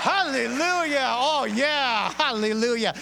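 loud, drawn-out happy hallelujah; cheerful hallelujah. Shown as a demonstration. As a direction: model a joyful response. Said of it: "let's try it out right now".

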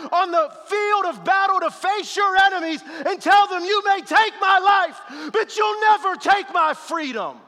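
loud, high, driving sermon climax; full-force preaching. Acted. Pastor stirring the congregation to courage, shouting preacher. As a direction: build the room into a roar.

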